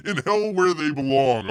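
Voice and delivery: loud, high mock outburst; mock booming reply. Host voices Jesus's reply in a viewer's joke, mock booming. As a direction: boom out the punchline.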